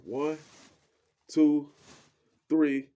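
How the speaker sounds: loud counted swing; counting out loud. Retold or imitated. Guys tossing him on the bed, loud count. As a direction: call out the count as they heave.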